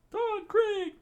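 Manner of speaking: very high, loud scream of a crowd; crowd's scream. Acted. Crowd cries out at Don Krieg, screaming. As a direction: shriek it out like a shocked onlooker. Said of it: "the crowd screamed".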